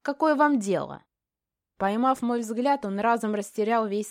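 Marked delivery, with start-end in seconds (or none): 0.0-1.0 high, indignant retort; snarling defiance. A narrator's reading. Brat baring teeth at master, defiant. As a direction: throw it back insolently. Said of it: "ощерился сопляк".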